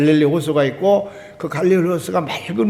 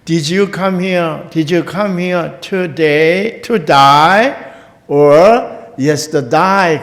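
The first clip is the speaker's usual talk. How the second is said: very slow, word by word, ending louder; slowly; 2 semitones higher, 5 dB louder, much slower than usual. Retold or imitated. Taxi driver repeats the question to the tourist, very slowly. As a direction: spell it out slowly for a confused tourist. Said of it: "천천히 얘기를 했습니다".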